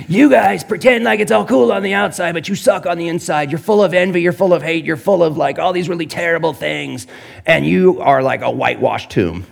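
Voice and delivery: high, scornful rebuke of hypocrites; mock scolding Jesus. Jesus reaming the religious leaders, high mocking scold. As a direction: scold them like a fed-up prophet. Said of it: "Jesus reaming religious leaders".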